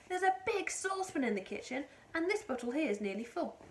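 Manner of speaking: put-on high, loud boy's voice; George answering. A narrator's reading. George telling his father about the medicine. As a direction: answer brightly.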